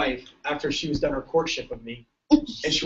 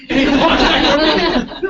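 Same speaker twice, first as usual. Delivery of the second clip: high, slow laughing narration; 9 semitones higher, 7 dB louder, slower than usual. Real, not acted. Speaker cracks up retelling the Texas cop story, laughing.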